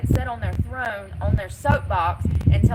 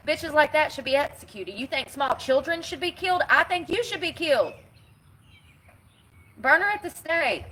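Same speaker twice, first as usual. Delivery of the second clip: heated rant; 6 semitones higher than usual. Real, not acted. Ranting about sign holders.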